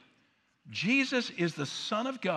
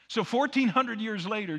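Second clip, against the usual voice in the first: usual speaking voice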